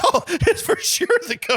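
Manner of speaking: very high, loud squealed certainty; mock incredulous outburst. Listener reacting to the welder's cocaine excuse, mock incredulous outburst. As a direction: burst out high and loud at the obvious.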